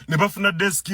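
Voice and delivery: ordinary talk, nothing marked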